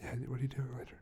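quiet secret side talk; hushed voices. Acted. Staged private side conversation.

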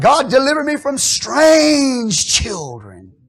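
loud, slow, drawn-out plea; crying out to God. Acted. Preacher voicing a prayer to God, slow and loud. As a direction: cry it out slowly like a desperate prayer.

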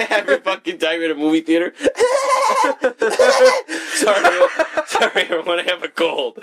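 wheezing, high, laughing apology; laughing cough act. Pretend. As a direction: fake a cough through laughter. Acting coughing fit in theater.

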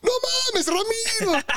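high mock yell; mock shout. Teen shouts to the bus about Ramiro, high mock yell. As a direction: yell it high in fake panic. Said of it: "yo grito".

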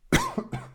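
sudden choking cough; choking on a drink. Real, not acted. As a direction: cough hard after swallowing wrong. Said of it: "hab mich verschluckt". (heftiges Verschlucken, Husten)

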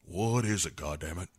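a hint of a grumpy uncle, dropping soft at the end; mock grumble, trailing off quiet; gruff uncle voice. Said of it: "And then he's like".

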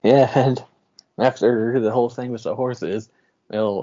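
ordinary delivery

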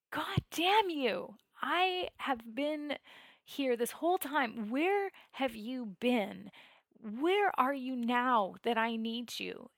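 slow, emphatic angry accusation; retold angry scream. Meditator demanding where God has been, furious reproach. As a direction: draw out each reproach, starting high. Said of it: "I was screaming".

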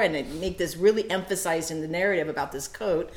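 normal talking voice

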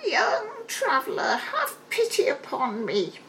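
high pleading crone; an old hag begging for pity; high witchy plea; old beggar woman. A narrator's reading. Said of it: "answered he".

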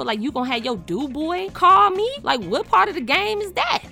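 high-pitched, offended questioning; indignant disbelief. Retold or imitated. Woman offended her crush's friend called her, indignant. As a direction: demand answers, pitch jumping way up. Acted indignation at stranger calling.

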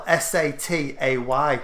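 letter by letter, slowly; spelled out. Shown as a demonstration. Friend proving how satay is spelled, slow spelling. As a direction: spell each letter separately and deliberately. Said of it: "it's spelled".

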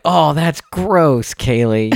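high, drawn-out groan of disgust; retold groan at a gross joke; mock disgust. Dad teasing his daughter over a bathroom joke, mock disgusted.

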